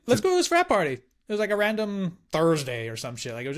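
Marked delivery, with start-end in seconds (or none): 0.1-0.9 high, eager party call; excited suggestion. Retold or imitated. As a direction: pitch up with college-kid excitement. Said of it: "we're like".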